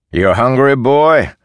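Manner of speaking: loud, high sneering question; taunting soldier. Acted. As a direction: taunt the hungry boy.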